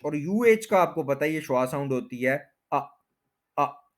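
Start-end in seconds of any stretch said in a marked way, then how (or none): 2.7-3.9 अ as a very short "uh"; schwa sound. Shown as a demonstration. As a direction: keep each अ tiny and separate. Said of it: "बस ये इतनी लंबी हो सकती है".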